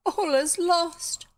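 high, drawn-out theatrical despair; crying out. A narrator's reading. Bet despairs before the gentlemen, high drawn-out melodrama. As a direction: wail it slowly like a melodrama heroine. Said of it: "cried Bet".

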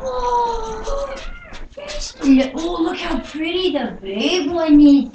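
high, loud delighted cooing; excited squeal. Real, not acted. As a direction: burst out thrilled to see the dog. Excited greeting the dog.

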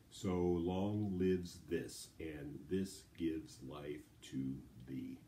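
slow, every syllable separated evenly; measured, drawn-out syllable count. Shown as a demonstration. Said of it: "Let's do the last two lines".

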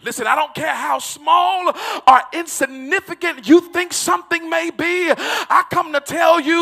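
loud, ringing preacher's shout over music; hollering sermon cadence building to a peak; full-force preaching. Acted.

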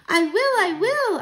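put-on high, loud, drawn-out child's voice; eager agreement. Mouse grandchild accepting the task, eager agreement. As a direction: promise it high, loud and slow.

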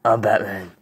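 low, quiet superhero growl; deep, hushed Batman. Acted. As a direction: state it low and soft, like a brooding hero. Playing Batman in a skit.

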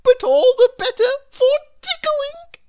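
very high, loud, jolly character; cheerful. A narrator's reading. Mister Tickle talks of his long arms, cheerfully. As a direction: whoop it out with glee. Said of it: "said Mister Tickle cheerfully".

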